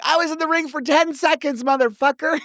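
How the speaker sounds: high-pitched indignant mock protest; retold outrage. Wrestler kicked out after seconds, retold outrage. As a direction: protest in comic disbelief, voice way up. Outraged at being eliminated so quickly.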